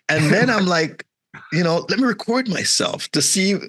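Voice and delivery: ordinary talk, nothing marked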